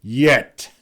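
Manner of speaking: loud, sharp single word; whip-crack shout. A narrator's reading. Heinrich silences the younger man, whip-crack shout. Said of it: "cracked through the room like a whip".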